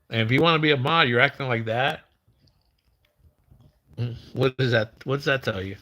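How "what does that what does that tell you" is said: ordinary talk, nothing marked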